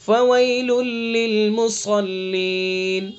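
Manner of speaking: slow, chanted recitation of the verse; Quran recitation. Acted. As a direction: recite the verse slowly and melodically.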